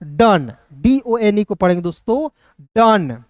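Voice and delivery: "डन" with a short a, not "डोंट"; right pronunciation. Shown as a demonstration. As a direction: say "डन", short and clean. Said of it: "बहुत लोग डोंट पढ़ लेता है". ("डन" का सही उच्चारण)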